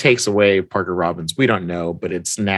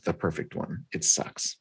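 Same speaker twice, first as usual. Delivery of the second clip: quiet sad quote; 4 semitones lower, 11 dB quieter than usual. Retold or imitated. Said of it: "She's like".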